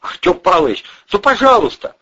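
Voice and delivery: high, whining entreaty; pleading. Acted. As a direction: beg with rising desperation. Young man begs the boss.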